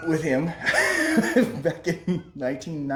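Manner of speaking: real laughter breaking through the words; laughing. Real, not acted. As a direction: laugh your way through the memory. Laughs recalling jail story.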